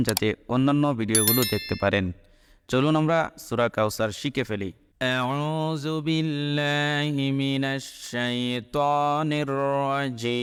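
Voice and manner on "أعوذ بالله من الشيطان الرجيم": slow, drawn-out chanted recitation; Quran recitation. Shown as a demonstration. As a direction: recite with long melodic vowels.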